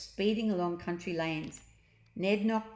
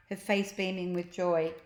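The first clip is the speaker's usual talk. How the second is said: usual speaking voice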